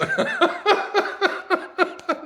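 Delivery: loud high cackle; high cackling laugh. Real, not acted. Laughing at his own joke, a high cackle. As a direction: burst out laughing. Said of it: "sorry for laughing".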